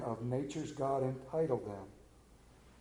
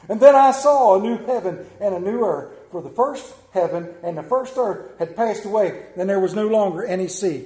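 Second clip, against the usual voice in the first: loud, higher preaching cry about heaven; full-force preaching; 8 semitones higher, 10 dB louder than usual. Real, not acted. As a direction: preach it out with fervour, loud and high.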